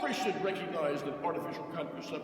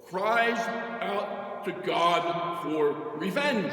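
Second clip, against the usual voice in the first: loud, slow, rising proclamation; quoted thunder; 4 semitones higher, 6 dB louder, much slower than usual. Real, not acted. Priest to congregation, a loud slow quoted cry. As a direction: ring out the quote for the church.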